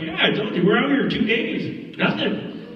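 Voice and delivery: high-pitched, exasperated retort; acted insistence. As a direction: insist it as if repeating yourself. His insisting reply in retold quarrel.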